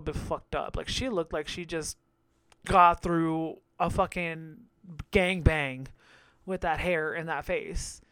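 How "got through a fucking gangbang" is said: usual speaking voice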